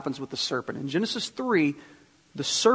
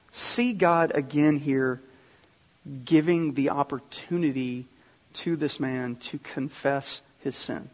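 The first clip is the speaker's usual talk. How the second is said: ordinary talk, nothing marked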